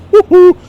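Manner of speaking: loud, high-pitched "woo-hoo"; high whooping noise. Retold or imitated. Fan describing an unpredictable club owner, high loud whoop. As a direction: let out a sudden high whoop.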